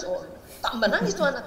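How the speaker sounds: normal talking voice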